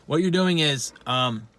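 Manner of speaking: ordinary talk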